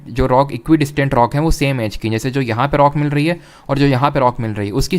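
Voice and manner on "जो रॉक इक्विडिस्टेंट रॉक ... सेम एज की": ordinary delivery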